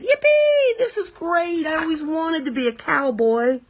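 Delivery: put-on little girl riding a rat like a cowboy, delighted; thrilled cheer. A narrator's reading.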